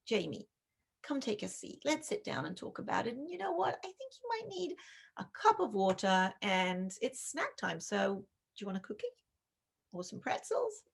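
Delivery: soft, calm and reassuring; calm gentle parent. Shown as a demonstration. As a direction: settle her down gently. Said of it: "put your own mask on".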